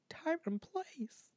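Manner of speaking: hushed, drawn-out whisper; whispered reaction. Retold or imitated. Host quoting their reaction to Reddit stories, slow whisper. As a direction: whisper it slowly as an aside.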